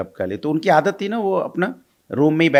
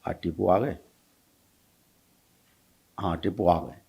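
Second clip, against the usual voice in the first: softer, lower drowsy murmur, half asleep; sleepy mumble; 7 semitones lower, 9 dB quieter than usual. Retold or imitated. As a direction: mumble it like an old man drifting off. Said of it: "नींद में क्या बोलते थे". (नींद में बड़बड़ाते हुए, धीमी और नीची आवाज़ में)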